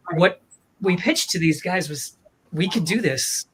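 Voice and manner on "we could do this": usual speaking voice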